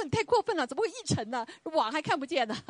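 high, huffy talking back; mock-offended wife arguing back; indignant retort. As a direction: brush off his complaint as unfair. She retorts during the quarrel.

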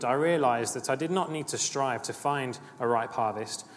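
normal talking voice

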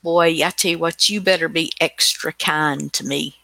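ordinary delivery

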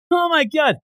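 high-pitched panicked commentator voice; mock frantic yelling. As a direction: squeal it like a scared play-by-play announcer. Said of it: "He's yelling about Tanjiro".

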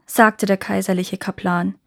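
ordinary delivery

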